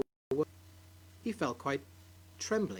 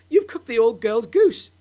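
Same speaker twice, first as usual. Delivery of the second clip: glaring wife; 5 semitones higher, 10 dB louder than usual. Acted. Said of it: "glaring at her husband".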